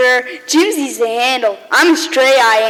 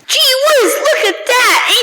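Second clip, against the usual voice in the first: thrilled wonder; 8 semitones higher than usual. Acted. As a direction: gasp it out like a kid seeing snow. Boy sees snow.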